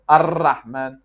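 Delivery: 'Ar-Rahman' with a long hard trill; Russian R. Shown as a demonstration. Teacher recapping mistakes, Russian rolled R. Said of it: "the Russian one".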